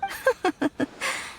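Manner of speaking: a short, pleased villain's laugh; amused laugh. Acted. Villain laughing at panicking festival crowd, amused. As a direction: laugh softly at the chaos.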